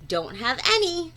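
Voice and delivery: plain reading voice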